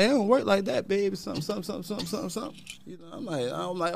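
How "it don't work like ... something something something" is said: higher, softer voice mimicking the woman on the phone; imitating the woman. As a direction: play the manager brushing him off, pitched up and soft. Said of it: "She like".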